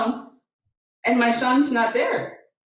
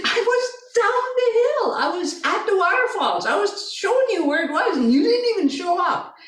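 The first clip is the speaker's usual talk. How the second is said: high-pitched retold arguing; mother insisting in a high, indignant voice; exasperated retort; 10 semitones higher than usual. Mother argues back, exasperated.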